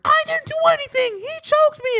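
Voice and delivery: put-on very high, wailing kid protest; Sean's mock bawling. Accused schoolboy blames the other, mock bawling. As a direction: wail it like a hammed-up crybaby. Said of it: "he just started bawling".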